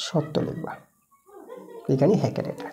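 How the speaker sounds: hushed whisper, like sharing the answer; whispered aside. Real, not acted. Teacher gives answer aside.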